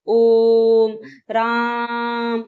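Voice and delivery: ओम राम with each vowel held very long, chant-like; very prolonged vowels. Shown as a demonstration. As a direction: draw out ओम and राम as long as the breath lasts. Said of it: "बहुत ज्यादा देर तक हम अगर उच्चारण करते हैं". (ओम राम बहुत देर तक खींचकर बोलना)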